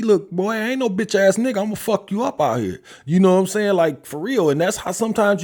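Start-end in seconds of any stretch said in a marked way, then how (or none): 0.0-2.8 higher-pitched, a fairly mild mock threat to an unruly son; a hint of a father putting his son in line; stern father warning. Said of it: "if my son was getting out of hand".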